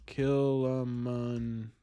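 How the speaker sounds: slow, low, drawn-out "Kilimanjaro"; sounding it out. Real, not acted. Streamer working out how to spell Kilimanjaro, sounding it out. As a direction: stretch "Kilimanjaro" syllable by syllable, quietly.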